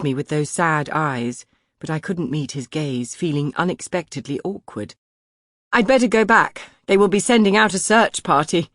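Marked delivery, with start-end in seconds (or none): 5.7-8.7 sarcastic. A narrator's reading. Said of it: "my ugly, unbidden sarcasm".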